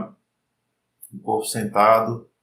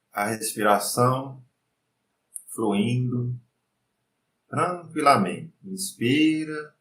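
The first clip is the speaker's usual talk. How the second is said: guided meditation; 3 dB quieter than usual. Acted. Leads breathing meditation.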